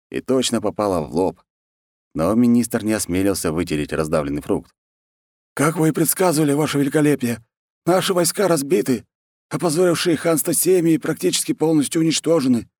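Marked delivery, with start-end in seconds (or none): 5.5-12.7 high-pitched servile military underling; grovelling war chief. Acted. War chief reports defeat to the khan, high grovelling voice.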